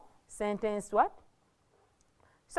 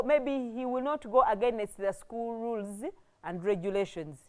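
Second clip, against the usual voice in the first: usual speaking voice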